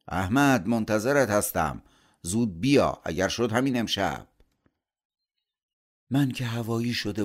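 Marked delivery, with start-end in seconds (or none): 0.1-0.7 loud, falling call of Ahmad over the engine; calling out a name. Acted. Uncle calls from departing car. 0.7-4.3 louder, urging call from a car window; loud, eager parting call. Acted. Uncle calling from departing car.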